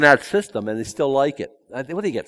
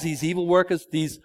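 ordinary talk

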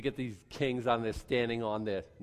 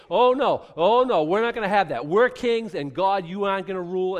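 higher, much louder outraged refusal; loud, higher mock outrage of kings; defiant kings shouting; 4 semitones higher, 9 dB louder than usual. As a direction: rebel kings shouting no at God. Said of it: "they unite and they say".

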